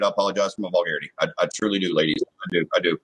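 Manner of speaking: calm yourself down and apologize; sincere apology. Real, not acted. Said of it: "I'm heated".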